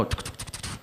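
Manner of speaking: soft unvoiced tuk tuk taps; imitated key taps. Brother faking work at the keyboard, soft taps. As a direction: tap it out under your breath like typing.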